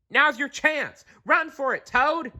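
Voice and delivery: high-pitched call; shouting. A narrator's reading. Animals calling to Toad to run, high pitched. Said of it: "the animals shouted".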